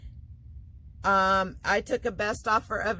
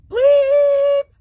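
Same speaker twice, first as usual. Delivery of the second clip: bleep sound; 16 dB louder than usual. Pretend. Censor beep sound effect.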